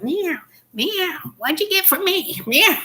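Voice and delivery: high squeaky kitty voice; cat voice. Acted. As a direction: talk like a begging cat. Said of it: "the cat's like".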